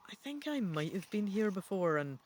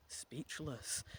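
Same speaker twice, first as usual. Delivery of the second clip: usual speaking voice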